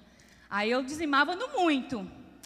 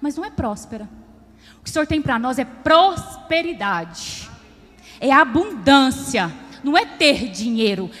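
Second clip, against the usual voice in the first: loud, driving preacher's voice; full-force preaching; 9 dB louder than usual. Acted. Preacher warning the congregation about money, loud and driving. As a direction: hammer the point to the church.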